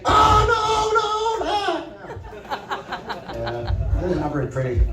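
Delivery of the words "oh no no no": high falsetto cry of "no"; mock prisoner wail. Inmate in the yard crying out, in a hammed-up high wail. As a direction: wail it far higher, hammed-up and pleading. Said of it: "it's more like".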